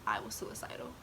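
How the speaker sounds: low, whispered admission; hushed confession. Real, not acted. Vlogger confides painful past, hushed. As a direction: voice sunk almost to nothing.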